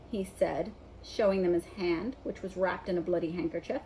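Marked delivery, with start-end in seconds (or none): none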